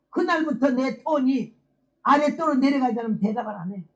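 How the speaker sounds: ordinary talk, nothing marked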